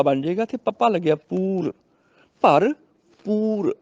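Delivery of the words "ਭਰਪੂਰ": ਭਰਪੂਰ very slowly, in two parts; first bh, then p. Shown as a demonstration. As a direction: split ਭਰਪੂਰ into ਭਰ and ਪੂਰ, higher and drawn out. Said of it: "ਪਹਿਲਾਂ ਪੱਬਾ ਫਿਰ ਪੱਪਾ".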